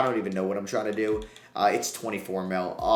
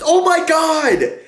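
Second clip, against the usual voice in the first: much higher, louder and slow, yelling in disbelief; ecstatic shout; 14 semitones higher, 11 dB louder, slower than usual. Real, not acted. Gamer after winning three straight, ecstatic disbelieving shout. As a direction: scream it like you can't believe the comeback. Celebrating comeback series win.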